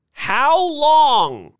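high, loud demand; loud, high-pitched dismay; alarmed outcry. A narrator's reading. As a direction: cry it out in protest. Ladies protest the delay.

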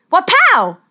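loud high burst; spell shout. Acted. Casting a spell loudly.

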